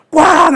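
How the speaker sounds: 높고 크게 쾅 외치기; 문 세게 닫는 소리를 입으로. Retold or imitated. 아내가 문 닫고 나감. (문 쾅 닫는 소리 흉내)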